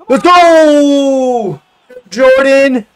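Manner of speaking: high, drawn-out yell of triumph; celebratory shout. Real, not acted. Gamer cheers his scorer, celebratory shout. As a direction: shout it long and high for the goal.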